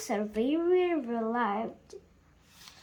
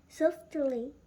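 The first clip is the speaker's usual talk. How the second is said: ordinary delivery, nothing marked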